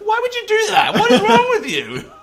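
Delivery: high, loud, laughing outrage; mock outrage. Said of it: "you're like".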